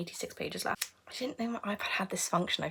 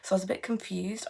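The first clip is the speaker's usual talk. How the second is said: usual speaking voice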